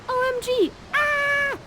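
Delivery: put-on very high girlish squeal; mock panic, ending in a little scream; Miranda. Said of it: "And Miranda's like".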